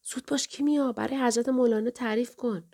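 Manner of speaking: high-pitched urging reprimand; scolding father. A narrator's reading. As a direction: chide the child to hurry up, pitched high. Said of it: "پدرم تشر زد". (با تشر و صدای زیر)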